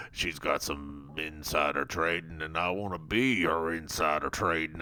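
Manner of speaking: very low, soft monotone mumble; Joe. Retold or imitated. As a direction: drone it all out on one low note. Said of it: "And Joe is like".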